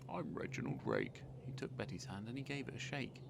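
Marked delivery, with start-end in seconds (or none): 0.1-1.2 much lower husky rogue; smug villain. Acted. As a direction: lay on the oily charm. New scarecrow introduces himself.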